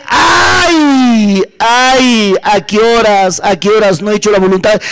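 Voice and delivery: long wailed "ay" sinking into lament; preacher's acted wail of despair; wailing cry. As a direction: imitate a damned man crying out in regret. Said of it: "grita y llora".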